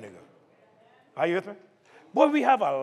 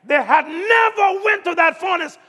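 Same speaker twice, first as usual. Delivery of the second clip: raised, loud preacher's cadence building the story; preaching at full force; 6 semitones higher, 3 dB louder than usual. Acted. Pastor retelling the fiery furnace to his church, preaching at full force. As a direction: drive the furnace story home to the congregation.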